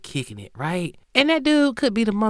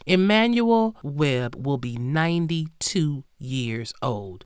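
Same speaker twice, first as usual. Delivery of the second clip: ordinary talk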